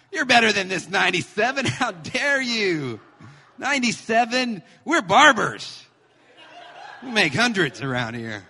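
loud, high-pitched hammed-up scolding; comic yelling, stretching the indignant words; mock furious dad. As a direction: rant like an outraged, overproud father. Mock strict father scolding daughter.

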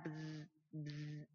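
soft buzzing buzz, buzz like a vibrating phone. Retold or imitated. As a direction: hum the phone vibrating quietly. Phone buzzing as text arrives.

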